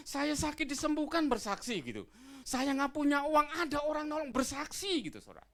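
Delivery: high, loud pulpit exhortation; full-force preaching. Acted. (berkhotbah lantang dan berapi-api)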